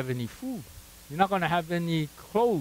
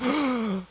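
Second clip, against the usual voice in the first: mock fearful gasp; 7 semitones higher than usual. Hearing about a layoff.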